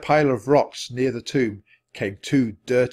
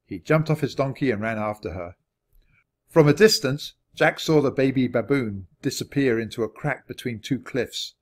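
ordinary delivery

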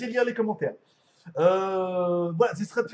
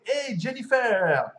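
drawn-out winner announcement; announcer flourish; 3 semitones higher, 3 dB louder, much slower than usual. Pretend. Streamer names the top heart-giver, slow showy announcement. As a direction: stretch the name like a show host.

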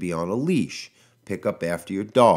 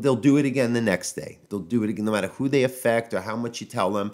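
ordinary talk

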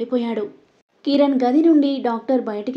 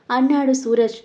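plain reading voice

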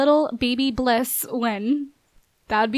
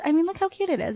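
ordinary talk, nothing marked

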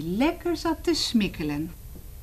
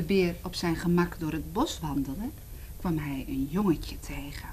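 hushed narration; 5 semitones lower, 5 dB quieter than usual. A narrator's reading. Storyteller hushes.

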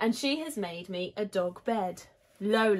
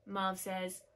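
plain reading voice

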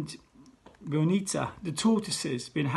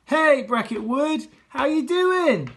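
high, loud, slow TV presenter greeting; head teacher greeting school like a TV show; showbiz host; 9 semitones higher, 7 dB louder, slower than usual. Acted. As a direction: work the crowd like a game show host. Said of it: "I said".

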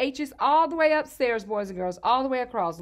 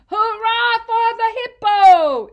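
high, loud, slow cheering; the animals cheering the hippo who saved them; happy shout; 11 semitones higher, 11 dB louder, slower than usual. A narrator's reading. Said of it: "everyone shouted happily".

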